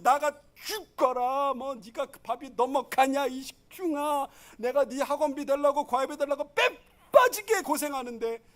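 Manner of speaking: hammed-up nagging mom; scolding parent. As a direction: drag it out like a fed-up mother. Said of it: "이게 진짜 엄마고".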